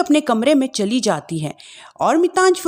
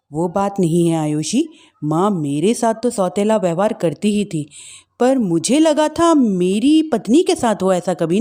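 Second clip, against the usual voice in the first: lower, subdued husband; sad husband voice; 4 semitones lower than usual. Acted. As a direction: voice a hurt grown son.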